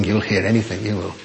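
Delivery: ordinary talk, nothing marked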